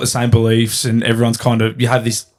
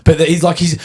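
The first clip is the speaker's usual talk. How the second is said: ordinary talk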